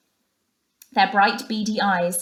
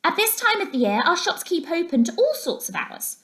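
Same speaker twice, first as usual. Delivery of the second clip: much higher little mouse voice; field mouse; 10 semitones higher than usual. A narrator's reading. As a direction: explain eagerly and politely. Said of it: "the first field mouse".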